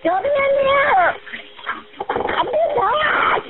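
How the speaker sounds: high, slow desperate screams; real terrified cries for rescue; screaming for help. Real, not acted. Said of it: "[name] screaming for help".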